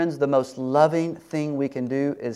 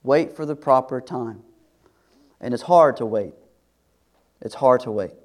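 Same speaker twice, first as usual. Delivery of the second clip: ordinary delivery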